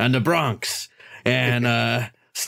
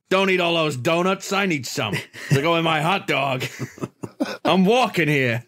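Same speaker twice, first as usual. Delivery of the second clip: higher, hammed-up Brooklyn voice; New York accent; 5 semitones higher than usual. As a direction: ham up a New York cabbie. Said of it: "making fun of me again".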